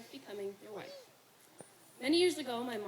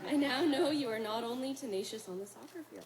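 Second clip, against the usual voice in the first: laughing; 14 dB louder than usual. Real, not acted. Bride laughs at her own joke.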